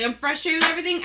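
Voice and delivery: ordinary delivery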